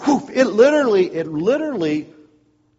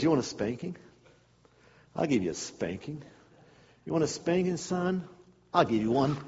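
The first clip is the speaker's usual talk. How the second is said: softer, lower, quiet menace; a father savouring the threat; menacing dad; 3 semitones lower, 6 dB quieter than usual. Retold or imitated. Dad quietly threatening his son with a spanking.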